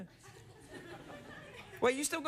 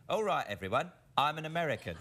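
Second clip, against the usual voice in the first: bad British attempt at an American accent; lower-pitched Englishman faking American; Michael Caine's American; 5 semitones lower than usual. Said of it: "And this is how he did it".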